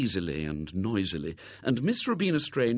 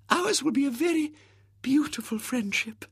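adoring spinster. Acted. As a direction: gush with sentimental admiration. Miss Rubina gushing.

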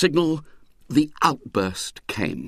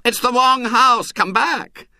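high, louder anxious shout; 12 semitones higher, 5 dB louder, slower than usual. Acted. Choirmaster shouting at the boys, high anxious shout. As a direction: shout a frantic warning.

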